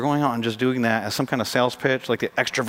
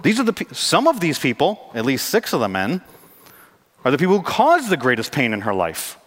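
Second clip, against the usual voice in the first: normal talking voice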